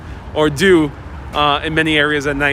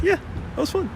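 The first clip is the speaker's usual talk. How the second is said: high squeaky voice; 6 dB quieter than usual. Retold or imitated. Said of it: "be like".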